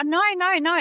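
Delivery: high, loud quick protest; emphatic denial. Retold or imitated. To an offended adult, a high loud protest. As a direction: cut them off, insistently. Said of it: "they would be like".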